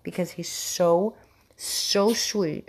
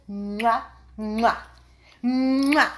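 usual speaking voice

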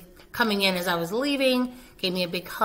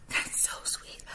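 soft breathy whisper, overcome; touched whisper; 12 dB quieter than usual. Real, not acted. Teacher moved by a student's plaque, whispering. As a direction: whisper it, moved by the kind words.

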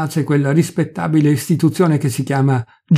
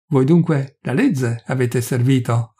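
ordinary delivery

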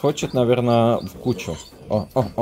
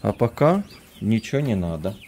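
usual speaking voice

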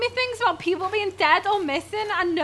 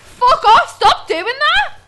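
loud, higher panicked shouts; frightened yelling; 8 semitones higher, 12 dB louder, slower than usual. Real, not acted. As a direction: yell at the monster to stop. Jump scare again.

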